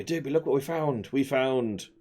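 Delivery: normal talking voice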